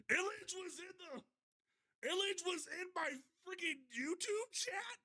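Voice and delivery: very high, incredulous outburst; shocked disbelief. Real, not acted. Stunned to learn who was in chat.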